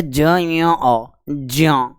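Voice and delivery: very slow "ज, य, अ" blended into "ज्य"; "ज्य" built slowly from j, y and a; sounded-out blend. Shown as a demonstration. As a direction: draw out each sound, then join as "jya". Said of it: "तो उच्चारण करके देखो".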